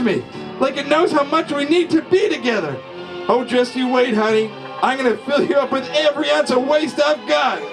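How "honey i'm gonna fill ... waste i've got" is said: usual speaking voice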